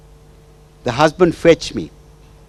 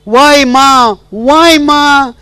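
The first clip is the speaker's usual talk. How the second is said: high, loud, pleading and tearful; tearful plea to mother; 6 semitones higher, 6 dB louder than usual. Retold or imitated. Wife pours out her hurt to her absent mother, tearful and loud. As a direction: cry out to the mother who left her. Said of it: "And she's crying".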